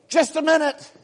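high, put-on absent-minded reply; distracted husband. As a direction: brush her off without looking up. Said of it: "And you go".

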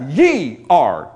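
"ye" punched and drawn out; emphatic YE. Shown as a demonstration. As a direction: hit "ye" hard, then let "are" fall. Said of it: "it's emphatic".

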